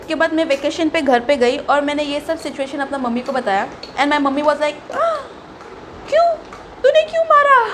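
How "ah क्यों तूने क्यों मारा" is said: alarmed mother. Retold or imitated. Mother hearing her daughter hit a boy, high alarmed scolding. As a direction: play a panicked mother scolding.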